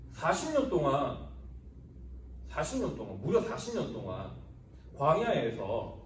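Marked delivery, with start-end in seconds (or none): none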